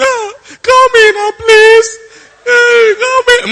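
very high, loud pleading wail; hammed-up tearful begging; mock begging. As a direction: plead loudly as if sobbing after someone. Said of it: "Men don't like begging".

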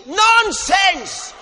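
one-word rebuke, loud and high; emphatic shout. Real, not acted. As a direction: shout it down as nonsense. Preacher rejects false humility.

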